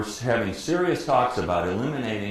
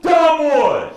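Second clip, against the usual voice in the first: very high, full-force drawn-out holler; Split yelling; 12 dB louder than usual. Retold or imitated. Broadcaster heckling the umpire from the booth, yelling. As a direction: bellow it down at the umpire. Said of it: "he starts yelling".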